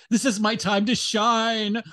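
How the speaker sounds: high, gleeful virtue-signaler voice; hammed-up self-important excitement; mock eagerness.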